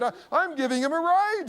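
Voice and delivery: high-pitched voicing of an older woman. Older woman to worried father, high-pitched reassurance. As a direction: wave off the worry in a high old lady's voice.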